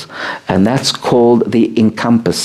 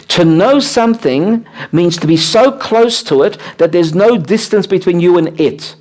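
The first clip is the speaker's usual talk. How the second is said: normal talking voice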